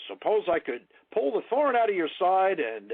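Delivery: "pull the thorn out" pitched high and loud; emphatic sales inflection. Shown as a demonstration. Trainer demonstrating a pitch to a prospect, strongly inflected "pull the thorn out". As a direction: raise pitch and volume through "pull the thorn out". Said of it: "a different tonality, different voice inflection".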